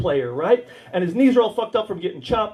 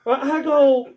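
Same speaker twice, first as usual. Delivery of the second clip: ordinary delivery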